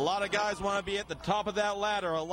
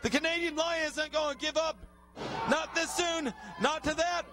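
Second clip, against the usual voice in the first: higher, excited ringside call; excited play-by-play; 6 semitones higher than usual. Acted. Commentator calling Billy Litt's comeback for the fans, excited and higher. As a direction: ride the comeback, voice lifted and urgent.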